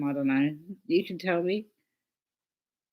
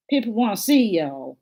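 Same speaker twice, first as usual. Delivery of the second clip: attempted Southern accent; 4 semitones higher, 4 dB louder than usual. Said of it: "I can't get the Southern".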